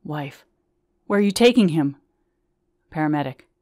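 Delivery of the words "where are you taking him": anxious call. Acted. Anxious wife calling.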